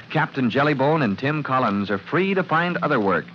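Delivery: ordinary talk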